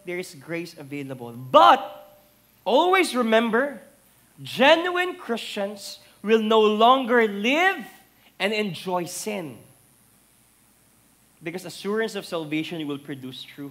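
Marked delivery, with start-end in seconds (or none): 1.4-9.6 sudden loud, high, slow warning; preacher urging the congregation; loud preaching warning. Acted. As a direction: burst out with the warning.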